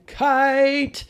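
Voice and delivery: high, loud triumphant "Kite."; excited kite. Acted. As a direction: announce "Kite." with the long I. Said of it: "Super E comes running in".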